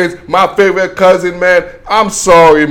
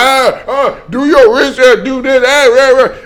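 mocking whiny fans; 6 semitones higher than usual. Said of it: "Bengals fans gonna be like".

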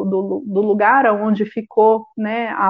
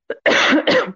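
sharp coughing fit, choked; choking cough; 5 semitones higher than usual. Real, not acted. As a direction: cough like something went down wrong. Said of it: "Perdão, engasguei aqui".

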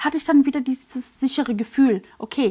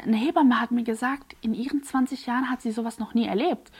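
ordinary talk

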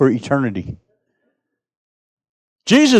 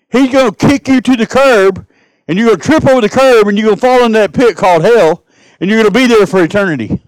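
higher, louder preaching warning; full-force preaching; 5 semitones higher, 4 dB louder than usual. Acted. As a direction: hammer the warning home.

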